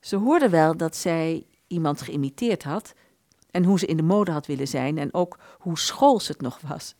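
usual speaking voice

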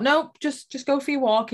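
lightly voiced pep talk to herself; chirpy self-talk. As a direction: cheerily nudge yourself onward. Retold self-talk leaving the house.

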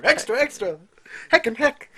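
high, loud paperboy call; newsie shout. Retold or imitated. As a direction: hawk papers like an old newsie. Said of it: "a newsie who just got his first newspaper".

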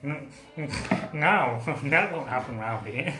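laughing, hammed-up posh voice; slow posh refusal, breaking into laughter; mock posh accent. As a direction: imitate a posh friend, amused. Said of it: "he sounds really posh".

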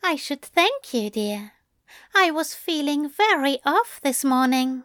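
high, teasing angel voice; murmuring angel. A narrator's reading. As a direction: set up a cheesy line in a high voice. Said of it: "Aziraphale murmured".

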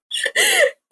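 short real laugh, pitched very high. Real, not acted. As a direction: burst into a brief high giggle. Laughs at own morning routine.

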